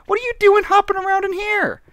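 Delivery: very high-pitched, incredulous telling-off; playful high-voiced scolding; mock scolding. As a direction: squeal it at a naughty little pet. Scolds a bunny in the game.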